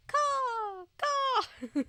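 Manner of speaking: high squawking crow caw. Pretend. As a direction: imitate a cawing bird overhead. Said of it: "I'm doing my own sound effects".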